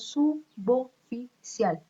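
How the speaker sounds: slow "suboficial" cut into su-bo-fi-cial; syllable by syllable. Shown as a demonstration. Teacher breaking "suboficial" into syllables for students, slowly. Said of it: "Cuatro sílabas también".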